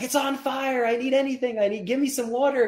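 high frantic pleading; panicked customer. Retold or imitated. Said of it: "he's like".

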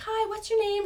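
high, sugary greeting; sweet receptionist. Retold or imitated. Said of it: "she's so sweet".

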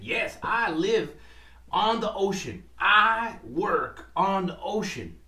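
lightly voiced sad fisherman. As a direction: lean on each word like a tired working man. Fisherman answers octopus.

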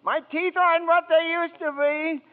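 high, loud cries for help; frantic shouting. Acted. Clarence biting the cop and yelling for Joseph, frantic. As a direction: yell in a panic while scuffling.

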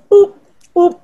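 a playful alarm call, way up high; high mock siren noise. To her friends before heading out, a high playful boop. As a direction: squeal boop boop up high and loud.

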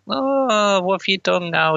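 high, fussing mock mother voice. Danny fussing at the taxi driver, a high mock fret. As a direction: squeal it like a fretting parent. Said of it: "Danny's all".

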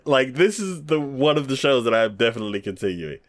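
ordinary talk

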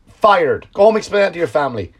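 ordinary delivery

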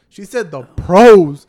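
slow, loud, leaning hard on "pros"; stress on pros. Real, not acted. Telling friends cousin wanted the pros, stressing "pros". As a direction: drag out "pros" for emphasis. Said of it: "she didn't say regular".

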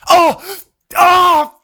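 high, loud yelps of match-watching agony; re-enacted gamer's outburst, high and loud; sudden outburst. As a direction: burst out as if reacting to a tense match. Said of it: "she'll just suddenly hear me going".

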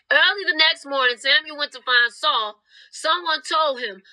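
ordinary delivery

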